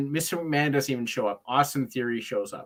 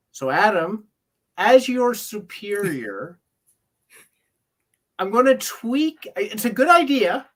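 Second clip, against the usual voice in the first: higher, slower, self-satisfied boss voice breaking into laughs; smug superior; 7 semitones higher, 4 dB louder than usual. Retold or imitated. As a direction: lord it over him with a smirk. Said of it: "and she's like".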